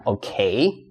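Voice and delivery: normal talking voice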